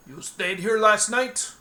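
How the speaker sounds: suspicious tavern keeper; gruff bartender. Acted. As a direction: challenge the stranger like a burly barman. Bartender questions Egil gruffly.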